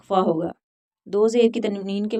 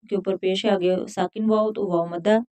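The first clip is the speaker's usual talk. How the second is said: normal talking voice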